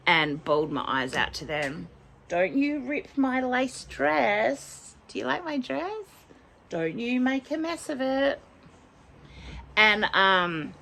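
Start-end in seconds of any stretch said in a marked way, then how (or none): 2.3-8.4 pet-directed scolding. Real, not acted. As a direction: gently scold your pet in a high voice. Talks to clawing pet in baby voice.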